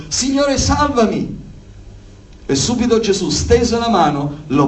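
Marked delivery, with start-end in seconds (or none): 0.1-1.4 high-pitched mild cry for help; restrained cry to be saved. A narrator's reading. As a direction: read it as an urgent plea, without full shouting. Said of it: "gridò dicendo".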